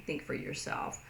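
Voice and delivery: voiceless whisper. Shown as a demonstration. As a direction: mouth it with no voice. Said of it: "they wanted to say".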